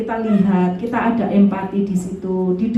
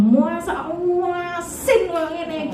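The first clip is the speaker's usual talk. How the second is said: drawn-out, sneering muasin, high and slow; sneering complaint; 6 semitones higher, much slower than usual. Shown as a demonstration. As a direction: stretch out muasin like a scornful husband. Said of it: "Nggak usah bilang". (suami mengeluh masakan asin, nada mencela panjang)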